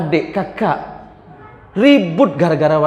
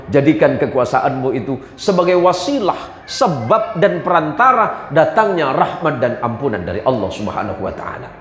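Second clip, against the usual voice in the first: normal talking voice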